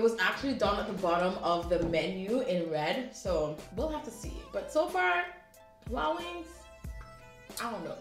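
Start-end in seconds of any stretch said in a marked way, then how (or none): none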